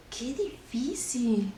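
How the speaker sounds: slow whispered complaint; whispering. Pretend. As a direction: breathe it out, barely voiced. Actress sighs at the difficulty.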